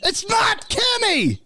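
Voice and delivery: very high, stretched-out mimicked shout; imitated far-off yelling, high and slow; yelling from the yard. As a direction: holler a correction across a yard. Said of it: "somebody in the yard goes".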